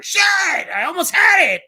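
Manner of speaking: loud, high shout of frustration; retold yell. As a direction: shout it like the high score just slipped away. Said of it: "she'll hear me scream".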